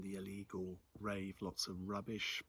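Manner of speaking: ordinary delivery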